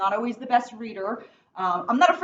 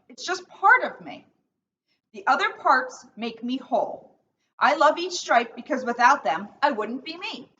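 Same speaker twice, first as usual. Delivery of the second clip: put-on reading to children. Officer reads picture book aloud.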